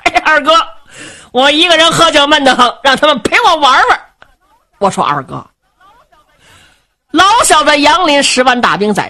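brash jovial rogue. Acted. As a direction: boom it out like a merry rascal king who doesn't care. Cheng Yaojin voiced in story.

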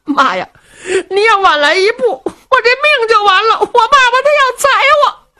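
high, fast tearful complaint; crying son. Acted. Luo Cheng to his mother, high crying complaint. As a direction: whimper to mother like a frightened son. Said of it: "罗成也哭了".

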